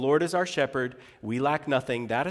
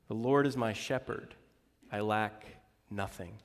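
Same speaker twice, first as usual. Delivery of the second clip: soft quote; 5 semitones lower, 6 dB quieter than usual. Acted. Closing psalm quote, softer.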